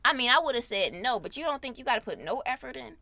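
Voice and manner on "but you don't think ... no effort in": ordinary delivery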